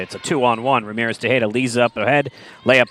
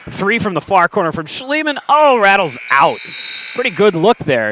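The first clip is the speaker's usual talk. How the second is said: excited call; 9 semitones higher, 4 dB louder than usual. Real, not acted. Near-miss three at buzzer.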